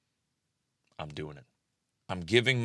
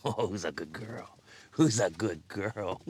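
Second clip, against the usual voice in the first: pet talk; slower than usual. Real, not acted. Talking to the dog.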